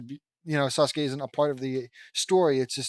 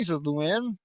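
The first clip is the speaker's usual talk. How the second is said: usual speaking voice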